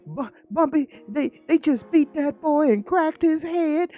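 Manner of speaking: a scared young girl blurting bad news; frightened girl. Daughter runs in and tells Bumpy in a high panicked voice.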